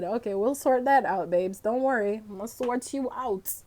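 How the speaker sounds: calm them down gently, babes; soothing reassurance. Retold or imitated. Reassuring the romantic couple in the game, softly.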